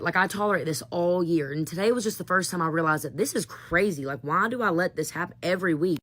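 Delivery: usual speaking voice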